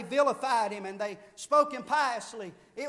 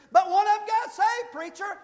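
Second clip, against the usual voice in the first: objecting listener; 9 semitones higher, 9 dB louder than usual. Retold or imitated. Imagined listener objects to preacher.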